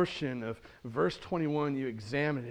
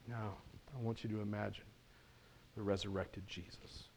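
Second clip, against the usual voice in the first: low, very soft, near whisper; hushed invitation; 5 semitones lower, 13 dB quieter than usual. Real, not acted. Preacher asking listeners to imagine the resurrected Jesus, hushed. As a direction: draw them in with a reverent hush.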